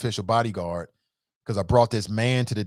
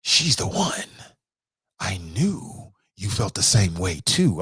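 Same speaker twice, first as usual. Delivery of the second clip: creepy Netflix stalker quote; 5 semitones lower, slower than usual. Retold or imitated. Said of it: "Dude is absolutely nuts".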